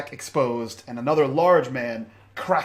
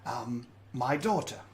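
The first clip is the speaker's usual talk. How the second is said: quiet menace; 6 dB quieter than usual. Acted. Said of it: "He says".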